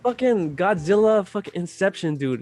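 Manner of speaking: ordinary talk, nothing marked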